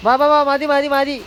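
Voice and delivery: loud, high call to stop. Real, not acted. Calling the child to stop. (കുട്ടിയെ ഉച്ചത്തിൽ വിളിക്കുന്നു)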